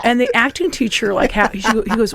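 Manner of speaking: ordinary talk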